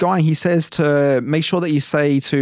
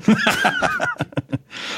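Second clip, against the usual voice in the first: high laugh. Real, not acted. Laughing at Batman joke.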